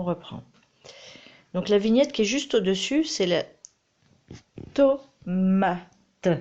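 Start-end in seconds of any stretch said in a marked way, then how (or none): 4.7-6.3 to-ma-te, each syllable clapped apart; "tomate" split into to, ma, te. Shown as a demonstration. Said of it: "Combien tu entends de syllabes".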